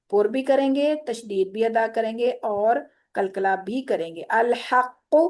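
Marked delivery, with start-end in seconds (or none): none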